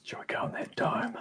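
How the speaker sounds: whispered question; breathy sneaking whisper. Real, not acted. Sneaking through a cursed forest.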